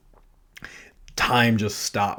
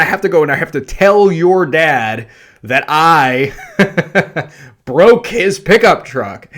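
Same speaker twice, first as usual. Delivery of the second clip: higher and louder, laughing disbelief; hammed-up retold panic at the wife; loud, laughing mock despair; exasperated outburst; 4 semitones higher, 6 dB louder than usual. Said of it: "I said to her, I'm like".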